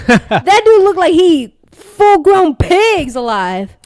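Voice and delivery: put-on Southern accent. Co-host bragging about his lineman, Southern accent hype. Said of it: "we do it in a Southern accent".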